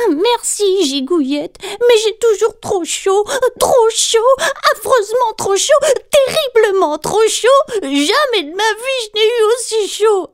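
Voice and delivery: high, loud complaining little voice; whining Galipette. A narrator's reading. Galipette whines to her big sister about the heat, high and loud.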